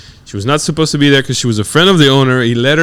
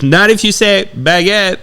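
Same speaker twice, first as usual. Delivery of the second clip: imitated heckler jab; 7 semitones higher than usual. Retelling heckler's jab at camera.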